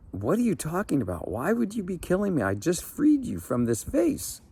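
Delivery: put-on a frightened old man arguing for his life; indignant, panicky old fisherman; alarmed protest.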